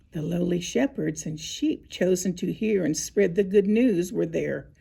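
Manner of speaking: plain reading voice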